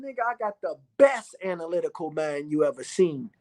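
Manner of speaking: ordinary talk, nothing marked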